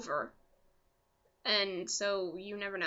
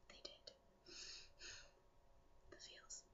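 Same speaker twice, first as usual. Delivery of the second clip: whisper; 29 dB quieter than usual. Real, not acted. Gushing over couple's wedding.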